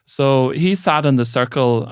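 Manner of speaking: ordinary talk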